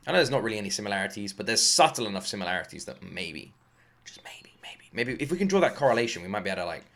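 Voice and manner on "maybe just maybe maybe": near-whispered, very soft; hushed hopeful aside. Real, not acted. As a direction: whisper it like a tentative hope. Tentative hope, said as aside.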